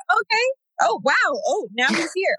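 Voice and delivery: high, amazed realization winding down; startled that the baby is already here. Retold or imitated. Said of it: "'cause I was just like".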